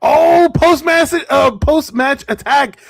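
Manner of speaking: loud, high, stretched-out call; excited shout. Real, not acted. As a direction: belt out the surprise attack. Calling sudden post-match attack.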